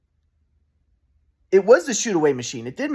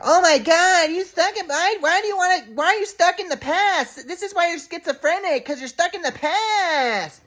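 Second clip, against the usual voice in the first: much higher put-on mom voice; mocking mom impression. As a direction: squeal it like the scornful mom. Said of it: "But you're like".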